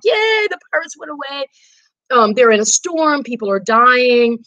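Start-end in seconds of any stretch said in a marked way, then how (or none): 0.0-1.5 mock cheer. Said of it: "they all were like".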